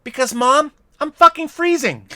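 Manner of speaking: put-on baby bear answering his mom, high and indignant; baby polar bear punchline. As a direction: deliver the punchline as a shivering little cub. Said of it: "looks up at his mom and says".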